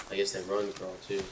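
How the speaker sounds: ordinary talk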